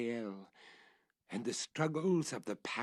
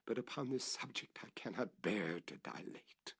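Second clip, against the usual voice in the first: whisper; 6 dB quieter than usual. A narrator's reading. Whispered aside.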